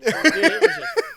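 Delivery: high, loud words through laughter; real laughter; laughing. Real, not acted. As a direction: barely get it out for laughing. Still laughing at the joke.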